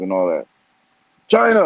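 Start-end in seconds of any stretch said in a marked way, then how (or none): 1.3-1.7 loud, higher-pitched punchline; sudden loud burst; emphatic exclamation. Real, not acted. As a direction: call out the answer to your own question. Answers own guess-who question emphatically.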